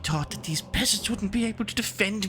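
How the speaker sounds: high-pitched, dramatic lady in relief and outrage; distressed noble mistress. Acted. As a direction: play a mistress aghast at her ordeal.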